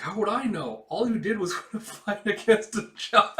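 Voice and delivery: high, loud cheeky retort cracking into laughter; girl mocking the warrior amid giggles; laughing through the line. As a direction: sass her, laughing. Girl mocks, reader laughs.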